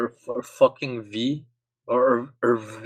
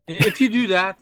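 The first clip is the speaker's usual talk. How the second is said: laughing through a mock threat; 7 semitones higher, 4 dB louder than usual. Real, not acted.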